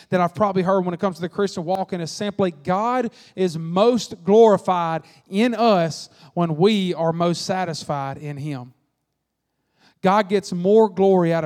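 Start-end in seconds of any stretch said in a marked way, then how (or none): 2.5-8.7 slow, each word set down with weight; slow, weighty quote. Acted. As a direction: let the saying land slowly and solemnly. Quoting the key saying slowly.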